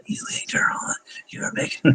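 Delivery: soft unvoiced whisper of a complaint; whispered mock wife. Husband imitating his wife's whispered scolding, whispered. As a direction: hiss the words like a wife grumbling in bed.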